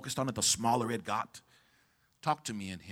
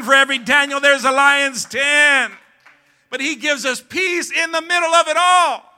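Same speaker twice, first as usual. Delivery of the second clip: loud, high, shouted preaching; preaching at full force; 11 semitones higher, 21 dB louder than usual. Real, not acted. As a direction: roar it out to the whole church.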